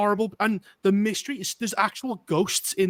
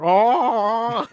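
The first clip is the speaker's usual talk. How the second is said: zombie roar; 3 semitones higher, 5 dB louder than usual. Retold or imitated. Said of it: "just going".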